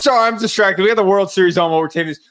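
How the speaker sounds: ordinary talk, nothing marked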